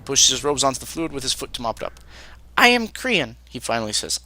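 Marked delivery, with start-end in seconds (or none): 2.6-3.3 higher, tired servant voice; a weary, put-upon cook; Krian voice. Acted.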